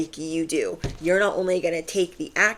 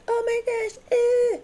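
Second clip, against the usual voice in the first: mock worry. Mocking a worried self.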